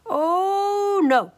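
high loud dismayed cry. A narrator's reading. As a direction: react to another bad taste. Tasting the cobbler.

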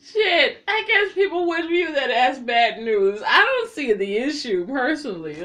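high, loud shrieks through laughter; screaming with laughter, barely getting words out; hysterical amusement. Real, not acted. Cracks up at the punchline.

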